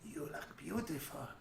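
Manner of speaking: slow, high, creepy creature voice. Acted. Voice under the chapel floor admires the praying visitor, creepily. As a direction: coo it slowly from the hidden dark. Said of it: "a voice, and it says".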